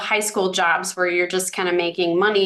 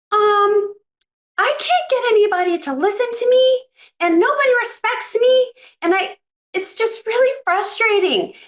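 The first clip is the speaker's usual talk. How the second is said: imitating a timid engineer in a much higher voice; high-pitched woman; 13 semitones higher than usual. Woman asking a trainer for help, mimicked much higher. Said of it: "it's too high pitched".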